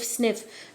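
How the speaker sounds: breathy sniff through the nose; sniffing sound. Shown as a demonstration. Teacher showing how Sniffles sniffs, breathy sniff. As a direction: sniff like a crying child. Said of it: "or going sniff".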